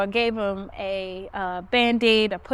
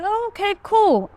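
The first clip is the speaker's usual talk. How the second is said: much higher, agreeable "oh, okay"; high-pitched polite reaction; 11 semitones higher, 5 dB louder than usual. Retold or imitated. Reacting to a customer who says he journals, high-pitched and agreeable. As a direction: go up high, playing along politely.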